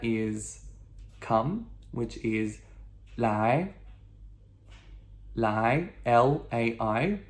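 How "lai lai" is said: "lai" rising like a question; ascending second tone. Shown as a demonstration. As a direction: lift the pitch through each "lai". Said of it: "ascending tone".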